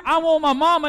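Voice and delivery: crying out I want my mama high and loud; child's plea cried out. Retold or imitated. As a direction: cry it like the whole church should hear. Child's plea in the sermon peak.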